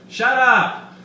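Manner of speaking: loud high heckling yell; crowd shout. Acted. Crowd yelling at Weiss, loud heckle. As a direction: yell from the stands.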